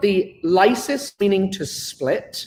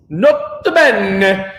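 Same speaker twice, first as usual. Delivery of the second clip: "Nota bene" drawn out, louder, Italian lilt; mock Italian accent; 2 semitones higher, 5 dB louder, much slower than usual. Shown as a demonstration. Teacher contrasting plain and Italian-style "Nota bene". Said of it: "Say it with an Italian accent".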